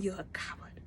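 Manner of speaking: scornful whisper; whispered insult. Retold or imitated. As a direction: hiss the insult under your breath. Whispered insult ending the scold.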